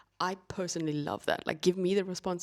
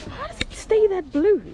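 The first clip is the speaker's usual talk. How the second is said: much higher, loud wonder; amazed; 14 semitones higher, 10 dB louder than usual. Real, not acted. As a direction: marvel out loud. Marvelling at the water.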